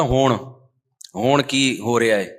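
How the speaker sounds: ہون said "hoon", with a long drawn vowel; mispronounced Punjabi. Shown as a demonstration. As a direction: stretch the vowel in ہون, hooṇ. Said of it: "ایک لفظ بہت غلط بولتا تھا".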